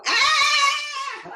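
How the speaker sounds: sudden high shout, hammed-up agitation; mock agitated shout. Shown as a demonstration. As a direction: let out a fake frantic "Ah!". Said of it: "pretend to be agitated ... Like that".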